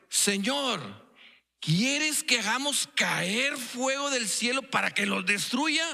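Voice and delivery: más agudo y lento, enfático; cita ruda y enfática. Retold or imitated. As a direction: pide fuego del cielo con furia contenida. Citando a los hijos del trueno. (hijos del trueno ofreciendo destruir, enfático)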